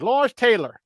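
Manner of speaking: high, loud Carolina coach imitation; North Carolina coach accent. Coach reveals his freshman roommate, North Carolina accent. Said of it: "he talks like this".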